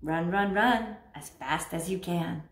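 low, quiet singsong taunt; mock taunt. Scorpio mocking those fleeing, low singsong taunt. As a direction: tease them to run, low and soft.

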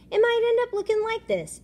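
high, loud playful quip; playful joke. Acted. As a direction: joke about his own head. Joking about hair.

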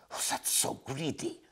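soft unvoiced disapproving tut; tutting. A narrator's reading. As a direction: tut at her under your breath. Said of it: "tutted Hugo".

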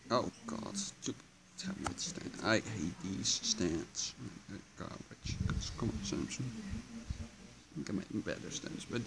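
muttering under breath. Real, not acted. Muttering at the broken stand.

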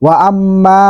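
ordinary talk, nothing marked

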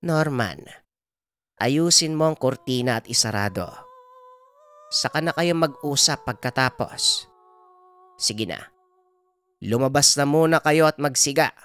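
lightly voiced grandmother giving firm orders; Lola Bilay, grave healer. Healer orders grandson and warns about the baby, grave. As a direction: take charge, then deliver the worrying news.

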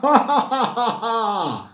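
high acted cackle; long, high run of ha-ha-ha; zebra's laughter. A narrator's reading. The zebra bursts out laughing at the striped mouse, high cackle. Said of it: "se echó a reír".

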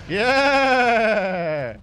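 loud shout then laugh; excited shout. Real, not acted. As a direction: yell it and crack up. Cheer after roar.

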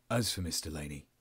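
low, subdued officer's delivery; low and matter-of-fact, in character; corrected officer's voice. Acted. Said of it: "now I'm Adrian as well as".

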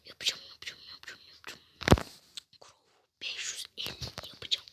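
soft repeated whisper to himself. Real, not acted. Whispering to himself while grinding for a score. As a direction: chant it under your breath.

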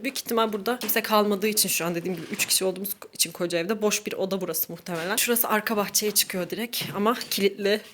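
ordinary talk, nothing marked

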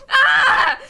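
short high scream, loud; loud "Ah!" tipping into a laugh; frustrated scream. Real, not acted. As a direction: scream out in exasperation. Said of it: "I'm gonna scream!".

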